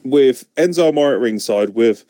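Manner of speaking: ordinary talk, nothing marked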